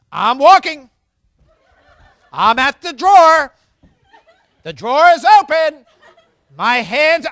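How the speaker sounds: loud mock threat, step by step, slowing at the end; a parent loudly announcing every step toward the paddle; comical threatening parent. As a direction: narrate each step toward the paddle as a hammed-up warning. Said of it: "some of them almost comical".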